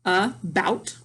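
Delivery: "about" as uh, pause, bout; split into syllables. Shown as a demonstration. Teacher pointing out syllables, "about" split in two. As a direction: pull "about" apart into two beats. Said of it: "are my syllables".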